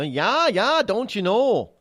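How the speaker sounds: loud, slow, high Minnesota sing-song; northern Midwest accent. Retold or imitated. Imitating Minnesota and Wisconsin locals, northern Midwest accent. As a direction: stretch the vowels in broad Minnesotan. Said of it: "to the northern Midwest".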